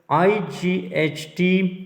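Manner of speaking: आई जी एच टी spelled letter by letter, very slowly; slow spelling. Shown as a demonstration. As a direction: drag out each letter of आई जी एच टी. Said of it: "ये की आवाज़ देते हैं". (आई जी एच टी को बहुत धीरे-धीरे हिज्जे करके)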